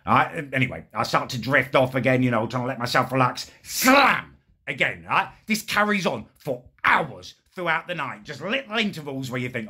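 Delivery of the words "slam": sharp high shout of slam; mock slam yell, high and loud. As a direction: bark out the door slam. Imitating door slam noise.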